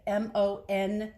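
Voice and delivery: slow, spelling each letter; spelled out letter by letter. Shown as a demonstration. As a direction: spell the name slowly. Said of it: "spelling out the soup name".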